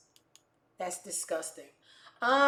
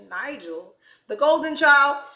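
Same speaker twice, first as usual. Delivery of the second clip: sarcastic jab; 5 semitones higher, 10 dB louder than usual. Real, not acted. Sarcastic about favoured brother.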